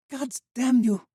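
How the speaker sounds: high, loud furious cry; a woman cursing the dead boy; anguished cry. Acted. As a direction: curse him in grief. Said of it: "Valeria cried".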